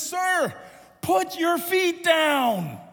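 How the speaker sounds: high, slow lifeguard holler; calling out. Retold or imitated. Lifeguard instructing a struggling boy, drawn-out call. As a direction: stretch each word to carry across water.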